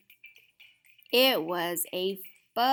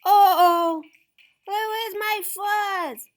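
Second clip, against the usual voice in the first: alarmed; 11 semitones higher, 9 dB louder than usual. Acted. Character panics over lost fuzz.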